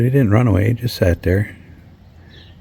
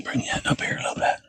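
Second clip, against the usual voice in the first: whispering; 10 dB quieter than usual. Real, not acted. Straining in cramped hot attic.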